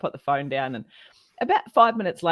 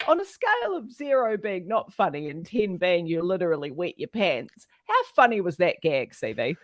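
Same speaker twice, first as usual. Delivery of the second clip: ordinary talk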